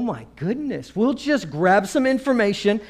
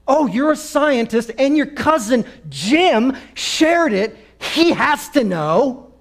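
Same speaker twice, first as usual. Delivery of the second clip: mock sarcasm; 8 semitones higher, 7 dB louder, slower than usual. Mocking Facebook believers.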